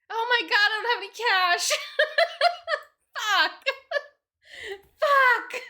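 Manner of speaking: high, loud laughing wail; laughing panic. Real, not acted. Broke streamer realising she has no cash, high laughing panic. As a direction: shriek it out between laughs.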